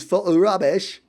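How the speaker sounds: hammed-up English accent; amateur English accent for a comic flourish. Shown as a demonstration. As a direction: slip briefly into a put-on English accent. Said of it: "no more English accents after that".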